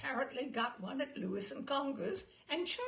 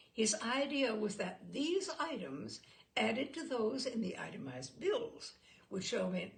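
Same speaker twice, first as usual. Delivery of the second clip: ordinary delivery, nothing marked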